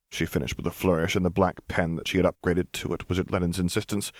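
ordinary delivery

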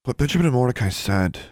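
plain reading voice

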